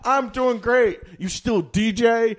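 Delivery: high, loud imitation of small talk; mock-eager reply. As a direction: play an overeager old acquaintance, stretching the end. Acting the friend's chirpy reply.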